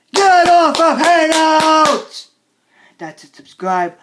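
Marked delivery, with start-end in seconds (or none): 0.1-2.3 high, slow, stretched-out scream; upset yell. Acted. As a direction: drag out the final word screaming. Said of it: "I'm getting really upset".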